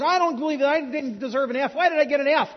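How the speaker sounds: loud, high protest, acting out a mad student; indignant student. Student confronting his professor over a grade, indignant. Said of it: "came back and was really mad".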